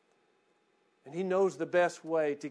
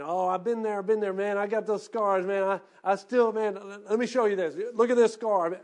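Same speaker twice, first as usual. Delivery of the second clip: puffed-up boasting, louder than the narration around it; mock bragging about scars; 5 semitones higher, 7 dB louder than usual. Preacher mimics a believer flaunting past hardships, boastful. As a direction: show off your old wounds with swagger. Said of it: "caught up in saying".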